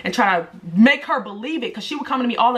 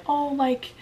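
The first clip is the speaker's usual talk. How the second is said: higher, prissy fake-sweet denial; mock sugarcoating; 7 semitones higher, much slower than usual. Refusing to sugarcoat, in a mock prissy higher voice. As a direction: lampoon the sugarcoated version. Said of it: "be like".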